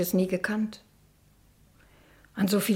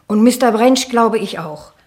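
louder, higher accusing outcry; loud reproach; 4 semitones higher, 8 dB louder than usual. Acted. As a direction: throw it at her. Nichte klagt laut die Tante an. (Nichte schreit der Tante ihr eigenes verlorenes Leben vor)

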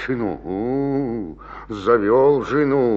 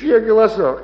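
laughing; 13 semitones higher, 5 dB louder than usual. Acted. Colas laughs at wife's nagging.

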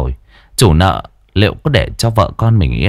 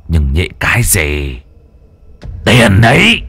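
boss snapping. Acted. Boss Tuấn to a henchman, snapping. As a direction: shut the objection down.

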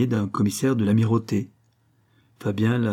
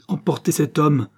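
louder, higher command; commanding order; 5 semitones higher, 4 dB louder than usual. A narrator's reading. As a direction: order the servants sharply. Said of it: "ordonna-t-il". (ordonner d'un ton impérieux)

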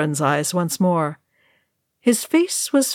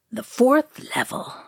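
wondering; 5 semitones higher than usual. A narrator's reading. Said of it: "said Balin in a wondering voice".

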